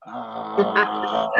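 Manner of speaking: low crackling fry like frying potatoes; vocal fry. Shown as a demonstration. Teacher comparing the fry to frying potatoes, creaky. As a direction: sizzle the sound at the bottom. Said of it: "es como ese sonido".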